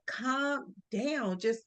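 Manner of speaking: quiet, slow calming voice; soft soothing mom. Retold or imitated. Mom quietly talking her panicked daughter down, slow and soft. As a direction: hush her gently down. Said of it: "and she was like".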